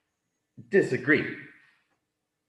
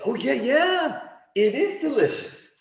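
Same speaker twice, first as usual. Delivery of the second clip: very high, excited acted reply; eager agreement; 13 semitones higher, 3 dB louder than usual. Dog agrees with his friend on spaghetti, eagerly.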